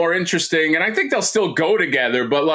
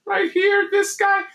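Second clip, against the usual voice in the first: mock Brian. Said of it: "He's like".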